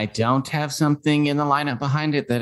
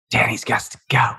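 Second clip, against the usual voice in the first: hushed, breathy Butcher threat; whispered menace. Retold or imitated. Butcher line voiced.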